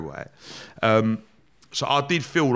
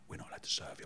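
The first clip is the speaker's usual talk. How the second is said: whispered crew member, acted out; hushed refusal; 19 dB quieter, faster than usual. Crew answering on night flight.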